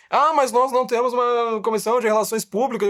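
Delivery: high, loud mimicked protest; mock whining objection. Mimicking an objector excusing themselves, high mock protest. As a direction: raise the voice into a mocking complaint.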